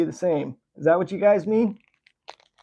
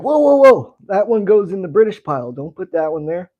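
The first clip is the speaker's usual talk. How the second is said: mock factory worker; 3 semitones higher, 4 dB louder than usual. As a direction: call out a halt in playful panic, then ease down. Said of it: "They're like".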